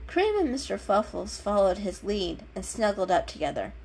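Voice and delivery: ordinary delivery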